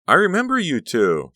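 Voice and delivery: put-on high, loud girlish gush; excited greeting. A narrator's reading. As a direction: burst out in happy recognition. Honey's gushing, tearful reunion.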